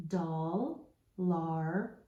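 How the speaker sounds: "Doll" and "lar" apart, "lar" rhyming with car; chunked syllables. Shown as a demonstration. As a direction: read "Doll, lar" as two separate parts. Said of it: "Let's read our parts".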